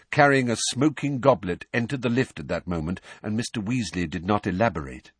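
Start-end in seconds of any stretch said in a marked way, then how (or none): none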